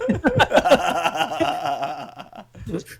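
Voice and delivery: evil giggle. Retold or imitated. Evil voice laugh.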